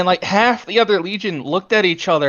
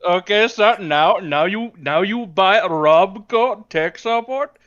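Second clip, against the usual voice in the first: slow sales pitch in a mock Indian accent; put-on Indian accent; Indian call center scammer; 2 semitones higher than usual. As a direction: spell it out as an Indian tech-support scammer. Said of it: "the Enclave Indian call center trying to scam".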